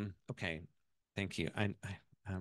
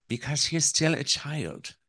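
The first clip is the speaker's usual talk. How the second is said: put-on higher Irish-accented pronouncement; 6 semitones higher, 3 dB louder than usual. Mother-in-law explains to the wife, Irish accent. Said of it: "that's my Irish accent".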